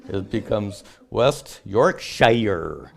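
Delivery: drawn-out New Jersey take on West Yorkshire; playful New Jersey accent on Yorkshire. Shown as a demonstration. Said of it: "in New Jersey".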